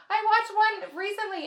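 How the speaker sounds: giddy confession. Real, not acted. Confessing with a laugh.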